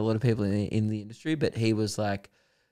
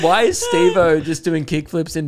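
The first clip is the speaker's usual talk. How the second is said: higher, louder mock disbelief through laughter; incredulous onlooker; 7 semitones higher, 8 dB louder than usual. Comic quoting a local who spotted Steve-O, loud laughing disbelief. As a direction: exclaim it high and loud, half laughing.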